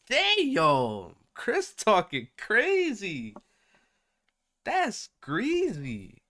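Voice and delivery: drawn-out, high disbelief; stunned. Real, not acted. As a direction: stretch it out in shock. Shocked reaction to threat post.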